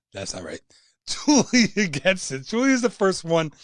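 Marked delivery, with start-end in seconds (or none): 0.1-0.6 breathy whispered aside. Real, not acted. Host quietly whispers that an answer is wrong. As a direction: drop to a whisper to reject it.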